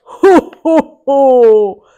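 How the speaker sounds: loud booming giant laugh; giant's booming laugh. Acted. Giant laughing down at Mickey, booming. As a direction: boom it out like a giant. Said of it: "came a rumbling noise from above".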